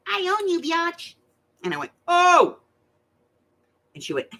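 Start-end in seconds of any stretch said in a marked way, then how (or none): 0.1-1.1 put-on tiny, very high-pitched baby squeak; cute baby voice. Newborn granddaughter claiming her grandmother, cute high baby voice. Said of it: "in the cutest little baby voice". 2.1-2.6 loud, high startled "oh"; mock shock. Grandmother hearing the newborn's claim, mock shock.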